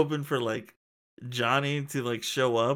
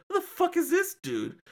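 baffled outburst. Retold or imitated. Telling a friend about the wrestler's reveal, baffled high squeak. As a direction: pitch jumps way up, a retold confused cry. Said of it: "I was like".

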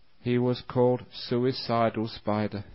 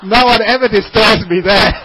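loud, higher words spilling through laughter; laughing punchline; 8 semitones higher, 17 dB louder than usual. Real, not acted. Preacher laughing at his own spider joke, loudly. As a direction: deliver the punchline while cracking up.

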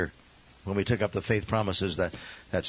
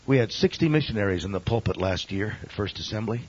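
usual speaking voice

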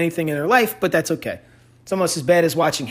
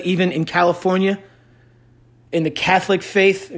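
usual speaking voice

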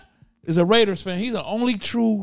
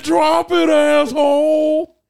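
loud, slow, drawn-out threat; tough-cop movie line; 6 semitones higher, 8 dB louder, much slower than usual. Retold or imitated. Friend imagines a cop line, loud and drawn out. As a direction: growl it like an action hero. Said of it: "I said".

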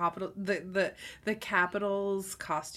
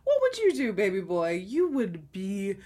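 ordinary talk, nothing marked

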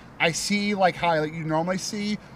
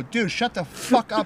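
higher, slow, exasperated telling-off; mildly mad, stretching out the words; retold anger; 5 semitones higher than usual. Storyteller acting out snapping at his pushy friend, mad. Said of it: "And I get mad".

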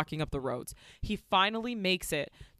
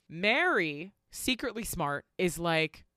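ordinary delivery

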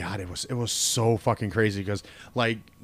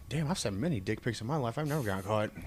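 mock muttering; 2 semitones lower, 11 dB quieter than usual. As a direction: mutter it under your breath. Mocking friend's inner voice.

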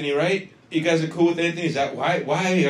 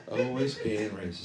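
much softer, dropped-down repeat; 2 semitones lower, 7 dB quieter than usual. Pretend. Comic repeating the accusation to himself, soft aside. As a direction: toss it off quietly after the big accusation.

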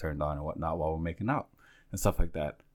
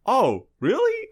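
high, loud, drawn-out mock astonishment; retold surprise; 5 dB louder, slower than usual. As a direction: stretch it out high as if baffled. His retold surprised reply to her.

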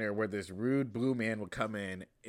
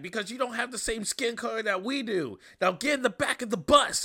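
mean monsters; 11 semitones higher, 4 dB louder than usual. Retold or imitated. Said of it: "the monsters are like".

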